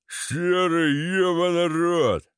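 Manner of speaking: mock bellow with stretched vowels; bellowing thug. A narrator's reading. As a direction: bawl it slowly in a lout's voice. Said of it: "раздался ор Вована".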